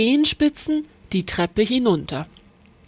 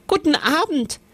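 put-on high, curious girl sniffing; eager little witch, higher; Lisbeth; 6 semitones higher than usual. A narrator's reading. Lisbeth smells the cooking, high and curious. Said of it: "sagte Lisbeth".

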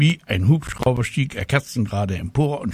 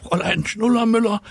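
high, excited pupil's cry; schoolboy calling out; 12 semitones higher than usual. A narrator's reading. Mirko alerting his teacher, calling out high. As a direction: call urgently to the teacher in a high voice. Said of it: "Mirko Rübel rief".

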